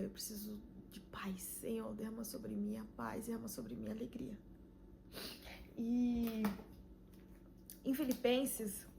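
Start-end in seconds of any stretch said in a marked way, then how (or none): 0.0-4.4 hushed pleading whisper; whispered prayer. Retold or imitated. Retold whispered prayer for joy.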